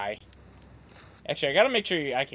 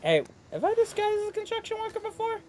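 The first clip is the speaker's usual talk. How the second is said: high squeaky voice; 4 dB quieter, faster than usual. Acted. Silly question voice.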